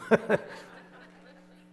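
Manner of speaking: high breathy burst of laughter; a real laugh from the pulpit; hearty laugh. Real, not acted. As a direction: laugh at your own joke. Preacher laughs at own joke.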